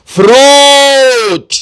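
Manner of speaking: فروٹ drawn out very slowly and loud; teacher modelling فروٹ; slow careful pronunciation. Shown as a demonstration. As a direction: model فروٹ one sound at a time. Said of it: "اس کا تلفظ".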